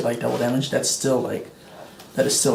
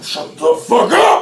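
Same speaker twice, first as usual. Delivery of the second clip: loud, slow, savage bellow; furious roar; 7 semitones higher, 7 dB louder than usual. Acted. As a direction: roar it while smashing his head. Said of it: "As he's laughing, like".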